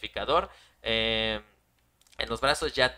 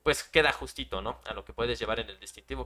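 ordinary talk, nothing marked

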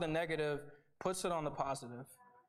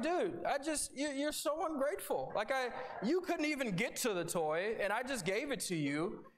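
higher, mock exasperated dad scolding; 6 semitones higher than usual. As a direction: playfully scold the baby. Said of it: "I'm like".

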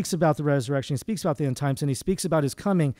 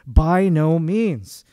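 slow, firm and louder; emphatic; 5 dB louder, much slower than usual. Acted. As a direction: plant each word: By no means. Emphatic preacher answer.

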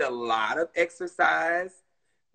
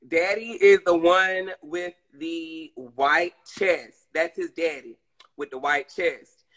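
ordinary talk, nothing marked

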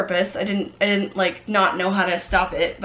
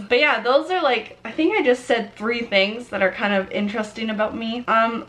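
ordinary delivery

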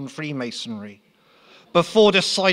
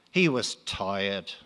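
usual speaking voice

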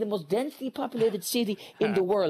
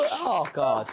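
usual speaking voice

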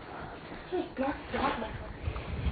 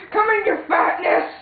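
mocking shout; 5 semitones higher than usual. Kid taunting a fat villain.

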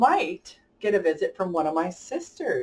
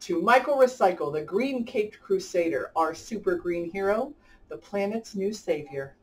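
lively rhyming storybook read-aloud; reading to children. A narrator's reading. Host reading a rhyming picture book to kids, lively storybook voice. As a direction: bounce the rhymes along for young listeners.

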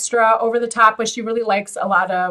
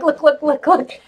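higher, excited mock showing off; imitating his eager look look; 6 semitones higher, 3 dB louder than usual. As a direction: point at it, eager and pitched up. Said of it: "And he'll be like".

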